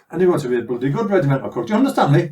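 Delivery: fast, loud, rising officer's bark; mock sergeant-major bellow ending in a laugh; barked order. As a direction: drill it into him, building.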